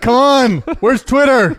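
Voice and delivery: high, loud comic wail; mock exasperated outcry. Speaker during an imagined internet outage, mock exasperated shout. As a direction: cry out in pretend outrage. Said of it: "I'm gonna be like".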